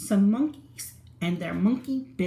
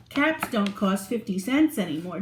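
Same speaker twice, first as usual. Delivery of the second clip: ordinary delivery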